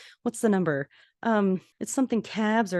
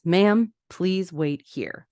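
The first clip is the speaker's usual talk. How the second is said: slow curt nurse; much slower than usual. Retold or imitated. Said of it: "the charge nurse says".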